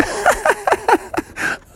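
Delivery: very high cackling laugh; helpless high-pitched giggling fit; hard laughing. Acted. Mom laughs hard at her prank on Froggy, cackling. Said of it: "Froggy's mom was laughing so hard".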